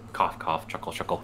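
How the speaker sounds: low, soft deadpan. Pretend. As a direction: say the noises flatly. Deadpan spoken sound words.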